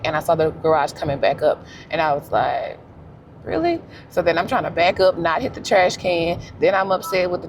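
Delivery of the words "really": high-pitched, questioning "really"; retold disbelief. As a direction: replay your incredulous reaction, pitched up. Retold reaction to returning garage door.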